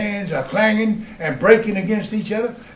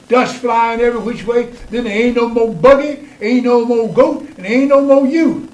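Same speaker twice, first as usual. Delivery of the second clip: higher, louder teasing warning; laughing farmhands teasing; 5 semitones higher, 4 dB louder than usual. Retold or imitated. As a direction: rib the boy like an old farmhand. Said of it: "laughing colored boys will hand me back the reins and say".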